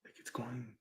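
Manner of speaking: hushed, tense whisper. Real, not acted. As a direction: breathe it out nervously. Tense whisper during Ouija session.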